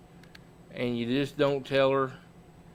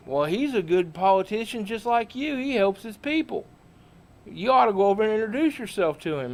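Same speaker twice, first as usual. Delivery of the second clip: friend Possum voiced higher, a touch hammed-up; Possum's voice; 6 semitones higher than usual. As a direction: play the sidekick, pitched higher and eager.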